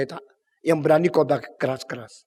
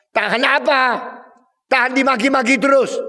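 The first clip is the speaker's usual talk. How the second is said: indignant outburst; 9 semitones higher, 6 dB louder than usual. Acted. Herodias arguing with Herod.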